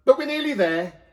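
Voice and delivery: high, loud cheer-up; rally them on; encouraging donkey man. A narrator's reading. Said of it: "said Donkey Man".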